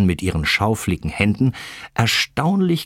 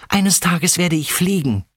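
put-on high, eager young voice; excited little dreamer's declaration; defiant calling out; 9 semitones higher than usual. A narrator's reading. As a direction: proclaim it with bright-eyed conviction. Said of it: "rief er".

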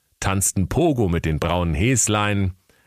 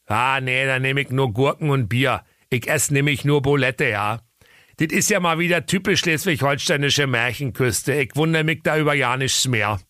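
put-on Berlin accent; 7 semitones higher than usual. A narrator's reading. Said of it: "Da sprach der Berliner Bär".

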